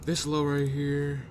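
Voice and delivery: words stretched by a yawn. Real, not acted. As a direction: say it mid-yawn, slow and open. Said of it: "I don't know why I'm fucking yawning".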